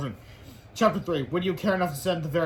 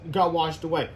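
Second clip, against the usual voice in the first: plain reading voice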